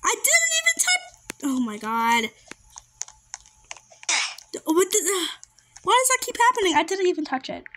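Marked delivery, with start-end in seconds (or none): 0.0-1.4 very high protesting wail; indignant squeal. Real, not acted. Player who died without touching it, indignant squeal. 5.8-6.8 high, exasperated complaint. Real, not acted. Player dying repeatedly in the game, exasperated.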